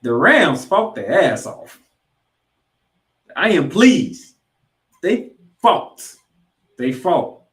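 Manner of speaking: slow, loud, proud declaration; slow and loud, proud of the team; a proud fan hammering the point home; proud emphatic insistence. Real, not acted.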